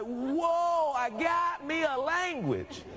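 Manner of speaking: high, drawn-out exultant cry; hollering with delight at a discovery; retold elation. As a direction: crow it high and slow in amazement. Reenacts amazement at his new language.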